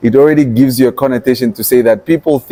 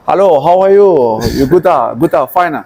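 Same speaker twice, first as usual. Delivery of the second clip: mock Chinese accent; 4 semitones higher than usual. Greeting a Chinese visitor in imitated Chinese accent. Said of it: "where I make an accent".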